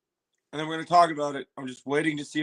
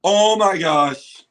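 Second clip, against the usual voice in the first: slow, drawn-out and louder oh my gosh; stunned awe; 3 semitones higher, 8 dB louder, much slower than usual. Real, not acted. Reacting live to the trailer, slow stunned awe. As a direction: let it sink in, amazed.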